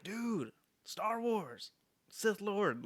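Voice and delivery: high, slow, drawn-out hype at a stoplight; excited stranger. Retold or imitated. Retelling a stranger's excited shout from his car. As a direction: gush like a thrilled fan yelling from his car. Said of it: "He's like".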